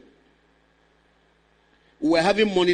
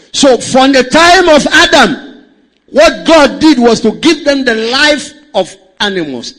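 loud, driving pulpit delivery; forceful preaching; 6 semitones higher, 12 dB louder than usual. Acted. Pastor preaching to the congregation, forceful preaching. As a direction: preach it hard to the church.

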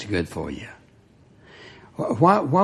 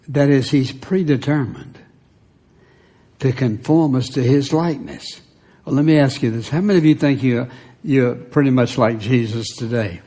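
ordinary delivery

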